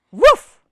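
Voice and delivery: one high, loud woof; single sharp puppy yip. Acted. Biscuit answering the reader, one high loud woof. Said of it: "And Biscuit says".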